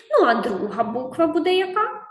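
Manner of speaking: ordinary talk, nothing marked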